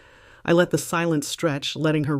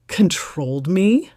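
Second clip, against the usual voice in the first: louder and slow, scornful echo; incredulous echo; 4 semitones higher, 6 dB louder, slower than usual. Acted. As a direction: throw her word back at her in scorn. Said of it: "I echoed".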